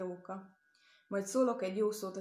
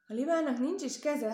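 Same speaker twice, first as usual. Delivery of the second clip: a lightly voiced boy cutting in, higher and louder; boy interrupting; 6 semitones higher, 7 dB louder than usual. Boy cuts in on the storyteller, higher and louder. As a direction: blurt it out like an eager little boy.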